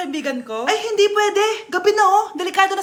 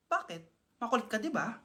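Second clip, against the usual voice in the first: menacing low voice; 8 semitones lower, 15 dB quieter than usual. Acted. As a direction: drop low and threatening, almost murmured.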